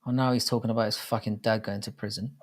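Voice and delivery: ordinary talk, nothing marked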